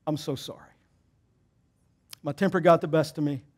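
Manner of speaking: quiet, humble apology; soft apology. Retold or imitated. Pastor apologising to the other dad, softly and humbly. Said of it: "I said".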